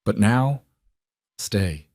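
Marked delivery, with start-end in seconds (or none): 1.4-1.9 softly spoken, understated request; quiet plea. A narrator's reading. Wife asks husband to stay the night, quietly. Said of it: "she said quietly".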